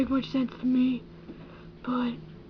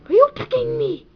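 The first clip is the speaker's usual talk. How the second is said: louder, high mock-alarmed; 10 semitones higher, 9 dB louder, faster than usual. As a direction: play it as a fake affront. Sudden outburst at friend.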